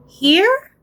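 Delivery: put-on timid high child voice checking the spot; small boy's voice, high and unsure. Little Laszlo asks the dark in the night, high and timid.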